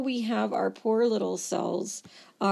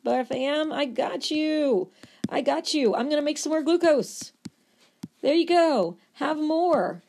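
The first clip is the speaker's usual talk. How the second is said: screaming liver; 6 semitones higher than usual. Acted. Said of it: "the liver's still screaming".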